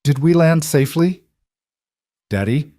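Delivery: lightly voiced child, high-pitched question; whimpering little child. A narrator's reading. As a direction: ask like a small child, a touch of a whimper. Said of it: "as she whisper whimpered".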